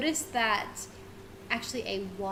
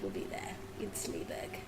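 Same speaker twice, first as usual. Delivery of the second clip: whisper; 3 semitones lower, 12 dB quieter than usual. Acted. GM trailing off in character.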